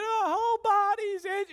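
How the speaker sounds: put-on very high, loud kid's wail; imitated distressed child. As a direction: wail like an itchy little boy. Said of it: "and he's like".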